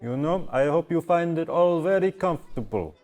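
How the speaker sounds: high-pitched, hospitable host voice; innkeeper character. Acted. Innkeeper seeing guests to their rooms, in character.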